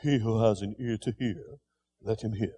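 low, soft, almost murmured; not cried out, just quietly said; quiet, plain saying. Shown as a demonstration. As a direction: say it softly, like an ordinary remark. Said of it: "He just didn't say".

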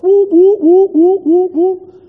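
high, loud whooping alarm siren; imitated alarm. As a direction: mimic a blaring burglar alarm. Alarm went off as they entered.

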